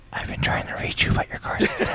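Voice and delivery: whispered warranty robocall; robocall impression. Cohost riffs on the punchline as a whispered robocall. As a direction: breathe it like a spam caller, laughing. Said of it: "I was thinking you were gonna say".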